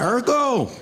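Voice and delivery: high taunt of a name; taunting shout. Retold or imitated. Kids taunting him at every turn with a high jeer. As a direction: sing it out like a playground jeer. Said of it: "Everywhere I went".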